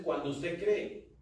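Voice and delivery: ordinary talk, nothing marked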